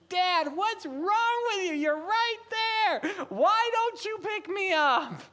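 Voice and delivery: put-on baby daughter's voice. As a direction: voice the crying baby begging her dad, high and pleading. Baby Ivy voiced, crying for dad.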